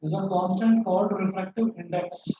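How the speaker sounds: slow dictation, word groups split by long pauses; classroom dictation. Real, not acted. Teacher dictating a physics rule to students, slow dictation. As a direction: dictate slowly so students can write.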